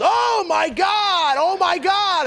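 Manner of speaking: high, slow, loud panicked yell, hammed up; imitating dad's panic. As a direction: play the father hit by the gun barrel, shouting. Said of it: "And he goes".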